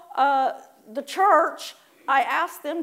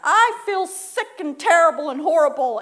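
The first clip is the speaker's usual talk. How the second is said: loud, raised moan; mock complaint; 5 semitones higher, 8 dB louder than usual. Shown as a demonstration. Preacher demonstrating a loud mock complaint. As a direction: wail the complaint. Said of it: "you also tag this on".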